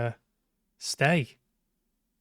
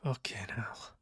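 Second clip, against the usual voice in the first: real shocked whisper under the breath; startled whisper; 10 dB quieter than usual. Real, not acted. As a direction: swear in a hushed whisper after a jump scare. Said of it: "That did scare me a little bit".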